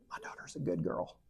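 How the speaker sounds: unvoiced secretive whisper. The woman whispering to him about her daughter, hushed. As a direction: hush it like a secret. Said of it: "And she goes like this".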